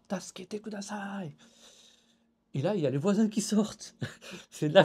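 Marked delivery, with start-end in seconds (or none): 0.1-1.4 retold cry for help. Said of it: "je gueule".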